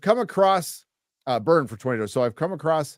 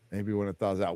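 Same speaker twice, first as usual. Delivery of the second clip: much softer echo, "thaws" falling; quiet repeat; 5 semitones lower, 9 dB quieter than usual. Real, not acted. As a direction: say it again under your breath. Repeats reply to himself.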